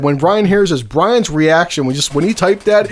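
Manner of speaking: usual speaking voice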